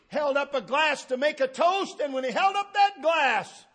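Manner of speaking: high, loud pulpit preaching; ringing out like a fired-up preacher; full-force preaching. Real, not acted. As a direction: preach it hard to the congregation. Preaching climax in a story.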